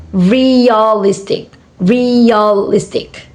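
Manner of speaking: realistic slowly drawn out as re-a-listic; syllable by syllable. Shown as a demonstration. Teacher showing four syllable word, syllable by syllable. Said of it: "four syllable words".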